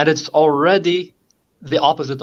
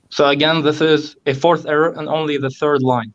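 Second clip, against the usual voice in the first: ordinary talk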